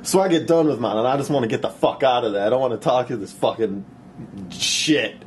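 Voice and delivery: normal talking voice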